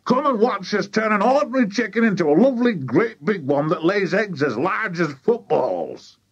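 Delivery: high, breakneck-eager boasting, slowing at the end; excited shout. A narrator's reading. Mister Cranky promises his wife giant eggs, excited shout. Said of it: "he shouted to Missus Cranky".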